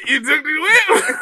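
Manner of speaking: high and loud, broken by laughter; laughing disbelief. Retold or imitated. Teacher asking if he took the car, laughing. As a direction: squeal the question through a laugh.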